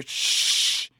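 long sharp "shh" to hush them; shushing. A narrator's reading. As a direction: hush the group firmly. Hushing the group in story.

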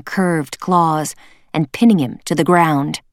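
plain reading voice

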